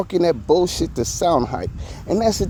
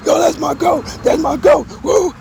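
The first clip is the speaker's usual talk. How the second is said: mock hype shout; 12 semitones higher than usual. Host mocking Academics cheering for [name], hammed-up hype shout.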